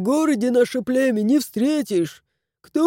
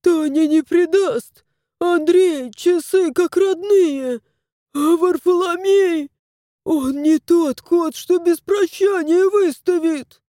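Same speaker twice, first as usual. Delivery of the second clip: desperate protest; 8 semitones higher than usual. Acted. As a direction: insist it can't be true. Old man protests in despair.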